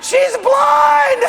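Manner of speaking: very high, loud hysterical squeal; shaking with laughter. Acted. Colleague on the floor reveals the truth, hysterical laughing squeal. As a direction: squeal it out through helpless laughter. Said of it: "he is shaking with laughter".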